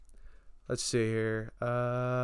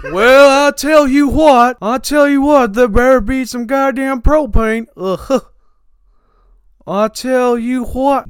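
Hank Hill; 4 semitones higher, 3 dB louder than usual. Acted.

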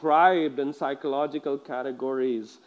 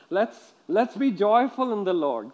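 normal talking voice

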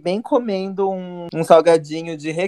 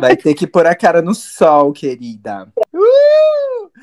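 sassy teasing; 9 dB louder, slower than usual. Acted. Teasing the guest loudly.

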